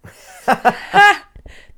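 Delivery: a sudden loud laugh breaking out; loud burst of laughter. Real, not acted. Cohost cracks up at the B B C joke, loud laugh.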